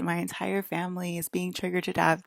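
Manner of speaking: ordinary talk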